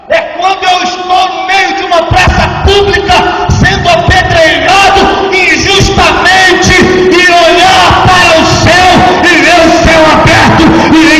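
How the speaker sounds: impassioned sermon climax; full-force preaching. Acted. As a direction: preach it with fire to the congregation.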